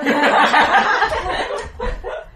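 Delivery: loud high burst of laughter; really cracking up. Real, not acted. Player laughing hard.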